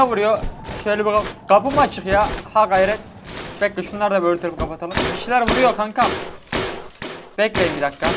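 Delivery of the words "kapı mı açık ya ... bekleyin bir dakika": ordinary delivery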